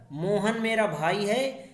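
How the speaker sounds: normal talking voice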